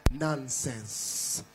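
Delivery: ordinary talk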